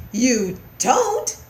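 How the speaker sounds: taken aback; wondering. A narrator's reading. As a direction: ask it in puzzled wonder. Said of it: "said questioned in wonder".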